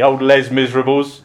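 "Les Miserables" as if a man's name, "Lez MIZ-ruh-bulls"; English "Les Miserables", like Les the miserable man; anglicised mispronunciation. Retold or imitated. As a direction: say "Les Miserables" in plain English, sounding every S. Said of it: "she always used to call".

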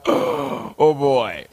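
startled gasp. Retold or imitated. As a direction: gasp it out as the wave rises. Faces a huge wave.

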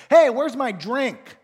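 loud, slow, high bratty call; impatient child shouting for a drink; demanding kid. Retold or imitated. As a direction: yell it like a kid waiting at the table. Child calling from dinner table.

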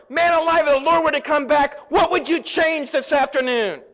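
loud, high, guilt-tripping sermon shout; mock hellfire preaching. Mocking a guilt preacher who berates believers, loud and high. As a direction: hammer the congregation like a fire-and-brimstone preacher. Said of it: "How many of you ever heard preaching like that?".

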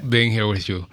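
put-on thick Cuban Spanish accent, brisk. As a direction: greet the host in a Cuban accent. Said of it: "I don't typically talk like that with my Cuban accent".

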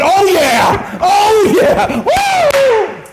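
very high, loud whoop; heaven cheering. Acted. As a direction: whoop it up like a celebrating crowd.

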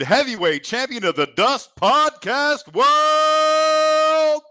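high, slow ring announcer build to a shout; wrestling promo. Acted. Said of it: "wrestling promo voice".

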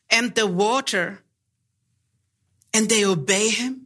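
solemn scripture reading. Acted. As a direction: read the passage solemnly to the congregation. Reads the scripture passage aloud.

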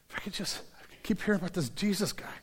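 hushed, fast, breathy inner whisper; whispered hope. Retold or imitated. The sick woman whispering her secret hope to herself.

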